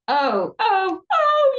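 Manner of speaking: high, loud wailing cries, building; hammed-up yelling carried into the narration. A narrator's reading. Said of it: "yells Mister Bumble".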